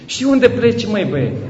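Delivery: high, loud, incredulous rebuke; mock scolding. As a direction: shake him up with disbelief. Bishop scolding the depressed father. (mustrare părintească, ridicată și apăsată)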